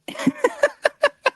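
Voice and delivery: high, sudden laugh at the memory; burst of laughter. Real, not acted. Laughs at his own accident.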